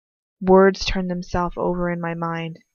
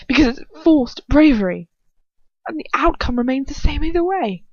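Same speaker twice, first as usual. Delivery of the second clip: loud, higher, demanding interrogator; menacing villain voice; 7 semitones higher, 4 dB louder than usual. Acted. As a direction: press him like a cruel dark lord. Villain sneers back.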